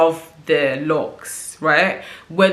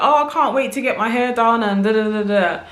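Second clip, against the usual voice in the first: lightly imitated excitement, pitched up; a higher, mildly excited mock quote; 5 semitones higher than usual. Imitating people keen to get their hair done, excited. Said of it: "you're absolutely excited".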